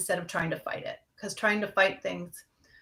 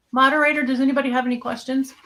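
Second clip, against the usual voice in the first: louder, higher call to someone off-mic; 5 semitones higher, 7 dB louder, faster than usual. Real, not acted. Host checks with the moderator for any questions, calling out. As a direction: call out to get attention.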